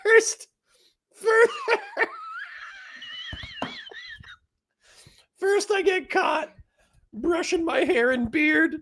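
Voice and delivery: high, breathless stammer through laughter; crying with laughter. Real, not acted. Streamer choking on laughter, trying to get words out. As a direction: try to speak but keep cracking up. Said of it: "I'm crying".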